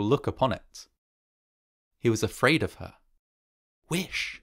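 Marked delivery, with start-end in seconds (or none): 3.9-4.4 high, commanding single word; strong-voiced cry. A narrator's reading. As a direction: command it without shouting. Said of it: "she cried in a strong voice".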